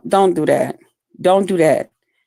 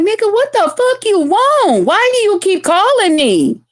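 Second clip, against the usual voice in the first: high-pitched retold tirade; raised-voice exasperation; 13 semitones higher than usual. Woman answers ex's repeated calls, raised voice. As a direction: act fed up, pitch shooting up. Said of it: "hear you kinda raise your voice".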